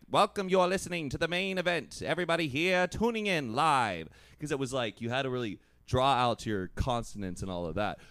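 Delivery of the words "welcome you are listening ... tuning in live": put-on old radio announcer. Explaining old radio voices, he does a mock announcer. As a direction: sell it like a vintage radio host. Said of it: "I'd be like".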